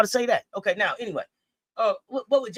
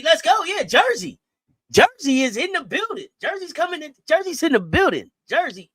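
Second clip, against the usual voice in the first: hype cheer; 8 semitones higher, 3 dB louder than usual. Acted. Cheering the player's entrance.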